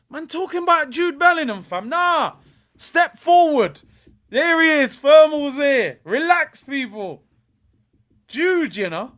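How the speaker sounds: high, loud swaggering hype; boastful shouted hype. Real, not acted. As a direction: shout down the doubters, triumphant. Hyping the goalscorer to viewers.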